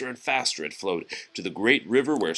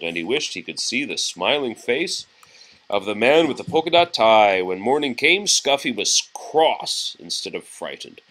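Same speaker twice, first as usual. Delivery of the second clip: plain reading voice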